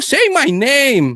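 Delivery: high, slow, drawn-out demand; hammed-up insistent challenge; mock commanding. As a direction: stretch it into a theatrical command. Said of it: "dapat sinabi mo".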